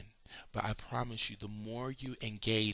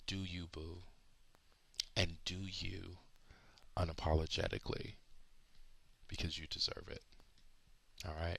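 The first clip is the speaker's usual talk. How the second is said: tender; 2 semitones lower, 5 dB quieter than usual. Acted. Soft closing encouragement.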